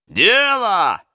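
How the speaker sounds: high shouted approval; robbers shout. Acted. Robbers shout approval of the new chief. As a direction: cheer it out as a crowd. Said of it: "закричали разбойники".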